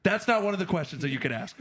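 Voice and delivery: mock-indignant gatekeeper; bridge troll. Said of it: "And he's like".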